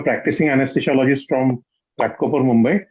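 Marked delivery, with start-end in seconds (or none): none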